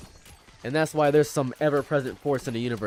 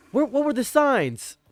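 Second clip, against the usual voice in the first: mock bafflement; 11 semitones higher, slower than usual. Mocking people's surprise.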